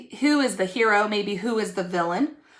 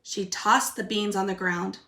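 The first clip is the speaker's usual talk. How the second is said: put-on lively storybook reading for kids; reading to children; 3 dB louder than usual. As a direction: read it like a picture book to little ones. Teacher reads story aloud.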